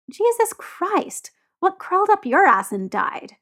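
high, drawn-out exclamation easing into a retort; exasperated. A narrator's reading. Tyler to disapproving Jamie, exasperated. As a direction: let the exasperation stretch the first words.

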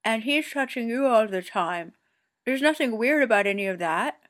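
words round a mouthful of burger; mouth full. A narrator's reading. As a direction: still chewing while talking. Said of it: "through a mouthful".

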